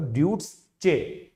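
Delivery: ड्यूट्स चे split in two, dyoots then a hard che, slow; another wrong guess. Shown as a demonstration. Showing viewers his earlier guess at the name, ड्यूट्स चे said slowly with a hard che. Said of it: "मैं पहले क्या सोच रहा था".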